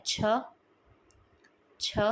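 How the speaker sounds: छ as an aspirated "chh" with a short vowel; beginning sound. Shown as a demonstration. Teacher giving the beginning sound to learners, sound demonstration. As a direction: say छ alone as a short, airy "chh-uh". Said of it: "इसका beginning sound होता है".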